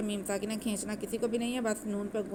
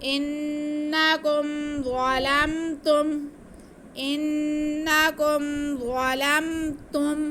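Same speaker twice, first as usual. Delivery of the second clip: slow, drawn-out melodic tajweed recitation, higher and louder; Quran recitation; 5 semitones higher, 5 dB louder, much slower than usual. Shown as a demonstration. Teacher demonstrating the recitation to students, slow melodic chant. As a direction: chant it slowly with nasal ghunna. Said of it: "इनको भी नहीं खींचना".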